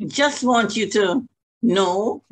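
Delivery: normal talking voice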